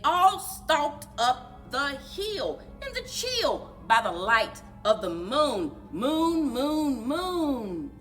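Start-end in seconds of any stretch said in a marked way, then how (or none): none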